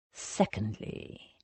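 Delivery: slow, hushed menace. A narrator's reading. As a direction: drop to a near whisper, slowly. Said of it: "he said in a lowered voice".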